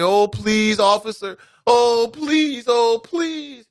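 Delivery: high, mock-pleading whine; begging officer. Mocking someone begging an officer to let them go, high whiny plea. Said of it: "get on your knees and beg and plead".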